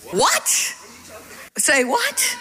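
high, sharply rising question; incredulous what. Shown as a demonstration. Preacher prompting the congregation for the word, incredulous. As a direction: toss out a startled what.

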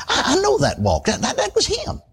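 I know that walk, it was him; sudden realization. Retold or imitated. Preacher realizing the man was his uncle, excited rising voice.

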